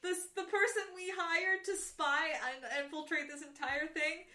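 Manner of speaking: loud comic henchman; guard voice. Retold or imitated. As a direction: bark it like a gloating guard. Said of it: "They're like".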